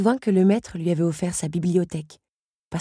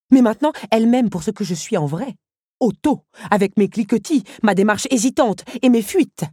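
louder, higher, earnest pride; proud Otto; 6 semitones higher, 5 dB louder than usual. Acted. Otto insists Belle loves him as he is. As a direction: own every flaw with pride.

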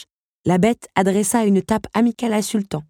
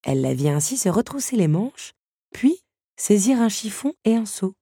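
ordinary delivery, nothing marked

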